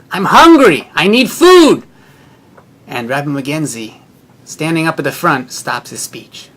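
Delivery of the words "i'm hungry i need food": loud, high desperate yell; retold shouting. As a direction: shout it out, begging for food. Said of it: "But he keeps shouting".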